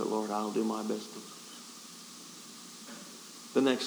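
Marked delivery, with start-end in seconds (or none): 0.0-1.1 low, soft surrender; resigned prayer. Retold or imitated. Preacher surrendering at the altar, in a low soft voice. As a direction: give in quietly, low and soft.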